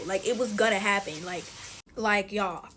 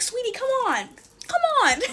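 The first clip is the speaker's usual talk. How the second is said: much higher, sing-song mom coaxing, louder at the end; mom's voice; 13 semitones higher, 5 dB louder than usual. Retold or imitated. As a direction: coax like an eager mom calling a kid over. Mom urging kid into car.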